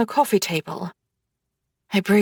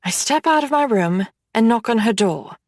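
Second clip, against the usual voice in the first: ordinary delivery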